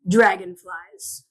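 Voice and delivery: dragged-out, dramatic announcement; slow dramatic. Acted. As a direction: draw the word out slowly, rising. Announcing gratitude card topic.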